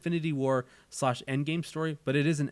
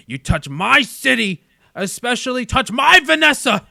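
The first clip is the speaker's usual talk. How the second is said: enraged shout, much louder and higher; Kingpin; 9 semitones higher, 13 dB louder than usual. Acted. Crime boss defends his city and his beloved, furious shouting. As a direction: explode into a possessive crime-boss roar. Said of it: "say it in my Kingpin voice".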